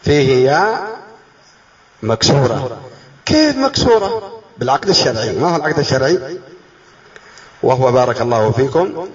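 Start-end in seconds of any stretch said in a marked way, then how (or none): 3.2-4.4 high, drawn-out incredulous question; mock student bafflement; baffled student. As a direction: question it slowly in a high, puzzled voice.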